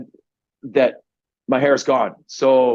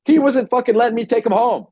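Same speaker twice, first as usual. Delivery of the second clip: put-on whiny kid; 8 semitones higher, 4 dB louder than usual. Son complaining to mom, retold.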